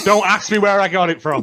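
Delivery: talking through laughter; laughing. Real, not acted. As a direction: laugh as you say it. Laughing after joke.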